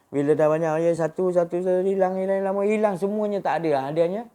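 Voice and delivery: rising, loud preacher's climax; preaching at full force. Acted. Preacher stirring the congregation, loud and high.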